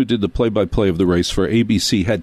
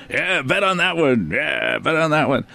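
a lightly voiced gruff bettor, a bit higher; rough guy at the betting window; 5 semitones higher than usual. Guy at the betting window urging a bet, lightly mock gruff. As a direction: toss it off like a guy with a cigar at the window.

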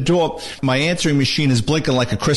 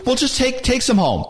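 higher-pitched retold motherly urging; lightly voiced mother pressing an offer; insistent mother; 9 semitones higher than usual. Mother pushing leftovers on her son, insistent.

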